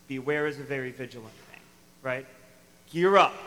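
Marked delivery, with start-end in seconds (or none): 2.9-3.3 sudden loud call to arms; rallying call. Acted. Preacher rousing his congregation to be ready, loud call. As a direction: rouse the listeners, loud and urgent. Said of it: "rallying the congregation".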